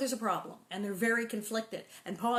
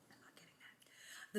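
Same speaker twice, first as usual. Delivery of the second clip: whisper; 18 dB quieter than usual. Real, not acted.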